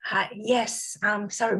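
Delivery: ordinary delivery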